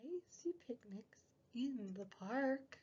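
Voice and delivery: whispered storybook line. Acted. Teacher whispers the book page to the children. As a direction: keep it a quiet secret.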